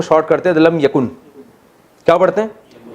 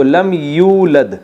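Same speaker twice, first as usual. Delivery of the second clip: slow recitation; much slower than usual. Shown as a demonstration. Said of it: "یولدو سے یولدو".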